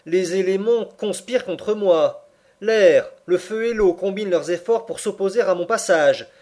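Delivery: loud, high-pitched indignant tirade; crying out. A narrator's reading. Uncle defying air, fire and water, crying out. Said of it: "s'écria-t-il".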